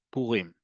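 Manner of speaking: Purim said slowly with the weight on rim; Purim, last syllable stressed. Shown as a demonstration. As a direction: land on the final syllable of Purim. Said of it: "the emphasis is on the last syllable".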